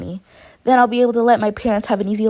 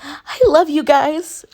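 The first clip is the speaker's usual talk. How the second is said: high, loud and drawn out; joyful cry; 10 semitones higher, 6 dB louder, much slower than usual. A narrator's reading. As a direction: cry it out in joy. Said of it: "noises of joy".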